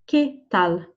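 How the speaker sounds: "¿Qué tal?" in two separated words; slow, careful "¿Qué tal?" for learners; word-by-word model. Shown as a demonstration. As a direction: say "Qué", brief gap, then "tal". Teacher models phrase word by word.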